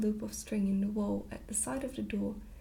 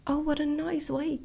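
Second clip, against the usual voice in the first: put-on higher and louder, full of childlike delight; delighted cry; 5 semitones higher, 5 dB louder than usual. A narrator's reading. Princess seeing the pigeonhole nests, delighted cry. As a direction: gasp it out as if charmed by a clever trick. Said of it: "cried the princess".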